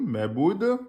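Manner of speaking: normal talking voice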